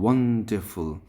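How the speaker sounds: Wonderful with a loud one; stress on first syllable. Shown as a demonstration. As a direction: punch one, let the rest fall away. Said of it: "ওয়ানের পরে আমরা stress দেব".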